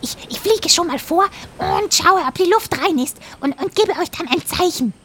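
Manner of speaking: high chattering parrot; eager little parrot chatter. Acted. Parrot character voice.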